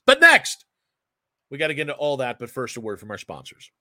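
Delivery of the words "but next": loud, high-pitched burst; sudden announcer shout. Real, not acted. Host throws to the sponsor break, shouting. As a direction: belt it out to turn the show. Host calls out segment transition.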